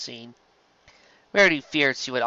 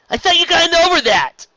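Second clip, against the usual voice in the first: much higher, loud incredulous cry; Rainbow Dash exclaiming; 9 dB louder than usual. Acted. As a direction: call out in disbelief.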